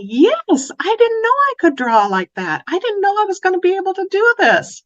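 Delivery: put-on high, fast, thrilled child voice; a little girl amazed at her own drawing; proud little girl.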